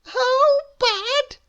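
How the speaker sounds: very high, slow sing-song voice; cheerfully refuse, very high; contrary Miss Contrary. Acted. Miss Contrary answers Mister Small, very high sing-song. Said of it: "she said".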